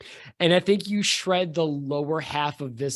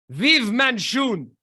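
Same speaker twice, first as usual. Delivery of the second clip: high, slow, ringing proclamation; villain's rallying cry; 6 semitones higher, 3 dB louder, slower than usual. Acted. Villain saluting his cause before the smoke, defiant cry.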